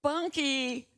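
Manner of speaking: high, loud wail for the blanket; child's scream. A narrator's reading. Toddler screaming after her blanket, high wailing scream. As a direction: shriek it as the blanket flies off. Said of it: "Tammy screams".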